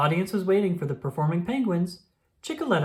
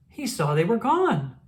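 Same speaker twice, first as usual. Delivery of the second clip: ordinary delivery, nothing marked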